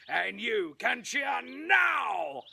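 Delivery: slow, shouted cue, stretched out; big cheer cue. Shown as a demonstration. Showman calling the audience to cheer, slow and loud. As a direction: bellow the cue to the back row. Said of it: "So, here we go again".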